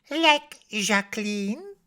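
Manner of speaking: put-on high, slow child's question; Josette. A narrator's reading. Small girl interrupting Papa's story, high slow question. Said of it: "asks Josette".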